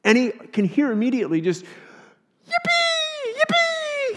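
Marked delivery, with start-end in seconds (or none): 1.6-4.1 very high squealing cheers; excited yippee. Acted. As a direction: squeal with a child's delight. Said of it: "laughing and giggling and so excited".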